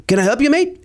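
quick mock British accent. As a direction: put on a British accent to challenge a stranger. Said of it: "they all have British accents".

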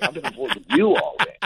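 a high, squeaky mock echo, as if caught out too; echoing squeak. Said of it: "and I'm like".

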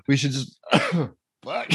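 choking on smoke after a dab; coughing through the words; coughing fit. Real, not acted. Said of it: "I might not be able to talk for a second".

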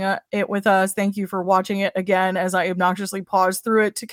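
usual speaking voice

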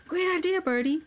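high, bright-eyed exclaim of relief; excited exclamation. Acted. Henry praising Birdie's idea, high excited exclaim. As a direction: cheer the idea like a happy kid. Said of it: "Henry exclaimed".

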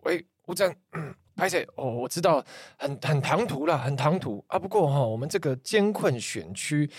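casual phone-call politician impression; 潘孟安. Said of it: "这个潘孟安就突然打line给我". (模仿政治人物打电话的口吻)

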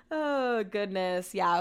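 ordinary talk, nothing marked

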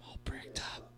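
whispered "I'm all bricked up" with no voice; breathy unvoiced whisper of "I'm all bricked up". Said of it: "he's like".

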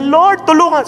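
reach out to the Savior; hopeful high cry; plea to the Lord. Retold or imitated. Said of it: "sasabihin na niya".

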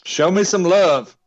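slow, loud begging for love; hammed-up plea.

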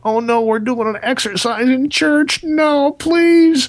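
pleading in mock horror, pitch climbing; mock panic. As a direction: ham up the dread of a forced church exercise. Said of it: "And I'm going".

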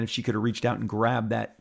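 ordinary delivery

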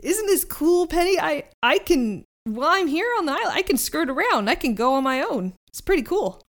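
high, excited goofy voice; bubbly boat showing off; goofy Herman voice. Acted. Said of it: "He's like".

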